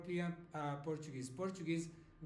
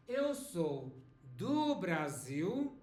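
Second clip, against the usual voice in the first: slow model phrase; slower than usual. Shown as a demonstration.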